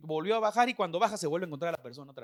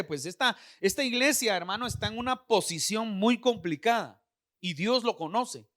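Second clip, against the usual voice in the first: ordinary delivery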